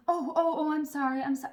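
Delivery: a hint of a flustered nurse saying sorry; apologetic phlebotomist. Phlebotomist apologizing after jabbing too hard, mildly flustered. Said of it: "And she went".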